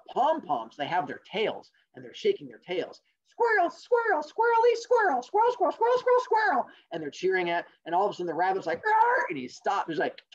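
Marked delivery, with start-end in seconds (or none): 3.3-6.7 very high, squeaky rapid chant; cartoon squirrels cheering; squirrel cheerleaders. Squirrel cheerleaders shake their tails, very high squeaky cheer. 8.8-9.3 very high, loud brake noise; screeching halt. Acted. Rabbit spots cheerleaders and stops, high screeching noise.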